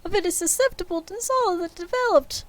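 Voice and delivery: high, hammed-up ghost voice; a wailing ghost warning Scrooge; ghost of Marley. Marley's ghost warns Scrooge in high hammed-up voice.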